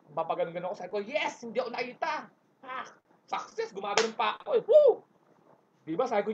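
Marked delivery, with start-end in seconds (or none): 1.1-5.1 triumphant cheer. Retold or imitated. Celebrating escape unseen.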